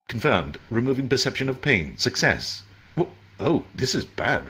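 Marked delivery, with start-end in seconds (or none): 0.1-2.6 lightly voiced AI announcement; even, detached computer voice; system voice. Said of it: "his voice was strange".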